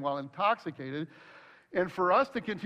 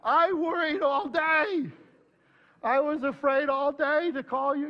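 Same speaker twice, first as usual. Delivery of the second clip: higher, louder, slow mock wail; a hammed-up fretful cry; imitated anxious outburst; 9 semitones higher, 4 dB louder than usual. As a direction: blurt out the worry you bottled up all day. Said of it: "I'm like".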